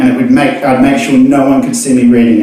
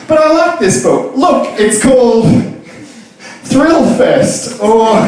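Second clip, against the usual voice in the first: higher, laughing pleading voice; pleading reader; 9 semitones higher, slower than usual. Acted. Reader to barman, laughing plea. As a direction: beg to stay, half laughing.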